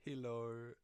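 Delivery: put-on New Zealand accent, giggling through hello; New Zealand hello cracking up; New Zealand accent laughing. Stranger greeting in New Zealand accent, laughing. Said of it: "laugh at these accents".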